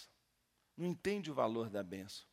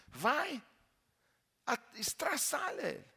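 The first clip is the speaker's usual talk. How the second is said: higher, louder dare; command; 13 semitones higher, 6 dB louder than usual. Retold or imitated. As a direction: push him on with a shout. Pastor dares the demon.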